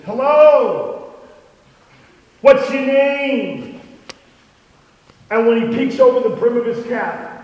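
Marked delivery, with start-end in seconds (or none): 0.1-3.8 put-on friendly bright call to a lonely boy, drawn out slow; a girl calls out hello, then slowly asks his name; girl greeting him. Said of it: "she goes".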